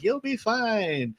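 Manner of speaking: high-pitched, drawn-out mock reassurance; retold reassurance. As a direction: stretch it out high, imitating him. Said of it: "then he's all like".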